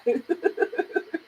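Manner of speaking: soft giggle; real chuckling. Real, not acted. Streamer amused by her [name] point, soft giggle. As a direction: let out a soft run of chuckles.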